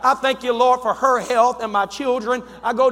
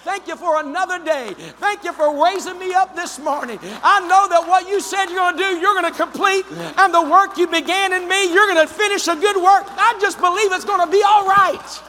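raised, fervent thankful praise; praise prayer; 4 semitones higher than usual. Shown as a demonstration. Preacher demonstrating his private praise with enthusiasm. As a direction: lift the voice in eager thanks. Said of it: "that's how I begin to praise him".

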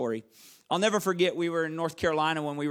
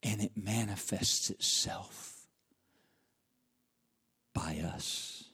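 much lower and softer, then a whisper; hushed, fading to whisper; 11 semitones lower, 9 dB quieter, slower than usual. Acted. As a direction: sink to a whisper. Preacher drops to hush.

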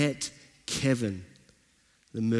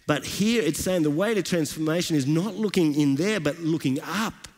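rising, fervent sermon delivery; preaching; 5 semitones higher than usual. Acted. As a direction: build the praise like a pastor lifting the room. Preaches the passage.

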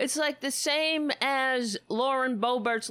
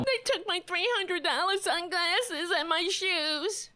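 mocking high whine; 9 semitones higher than usual. Echoing Bristol from the clip.

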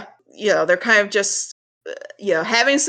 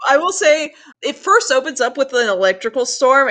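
usual speaking voice

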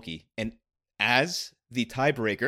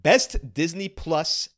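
announcer voice; 3 semitones higher, 4 dB louder than usual. Shown as a demonstration. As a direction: announce it to the crowd. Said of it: "I'll put on my announcer voice".

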